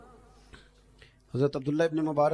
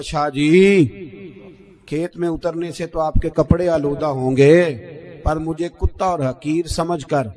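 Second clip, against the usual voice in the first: louder, earnest reproach; loud rebuke; 2 semitones higher, 12 dB louder than usual. Retold or imitated. Farmer rebuking the Shah about a soiled heart, loudly. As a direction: speak the farmer's lesson with full force.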